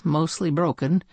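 usual speaking voice

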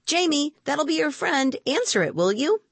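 high-pitched aunt's call; calling from the kitchen. A narrator's reading. Aunt Nora asks Jamie to get the door, calling out. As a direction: call through to another room. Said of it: "asked Aunt Nora from the kitchen".

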